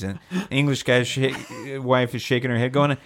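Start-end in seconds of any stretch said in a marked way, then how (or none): none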